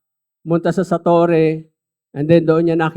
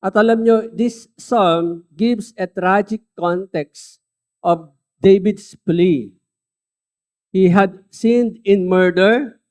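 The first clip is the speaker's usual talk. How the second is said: louder, slow preacher's build on each sin; preaching at full force; 3 semitones higher, 5 dB louder than usual. Real, not acted. Pastor to the church, naming David's sins, preaching loud and slow. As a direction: hammer each sin out slowly to the congregation.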